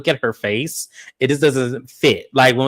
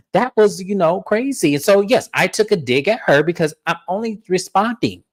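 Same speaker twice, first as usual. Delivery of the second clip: higher-pitched, insistent self-justification; defensive retelling; 7 semitones higher than usual. Retold or imitated. Co-host retells Lisa insisting she only responded, defensive. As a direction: protest your side in a raised pitch.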